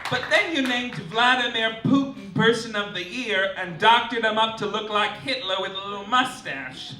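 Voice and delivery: ordinary delivery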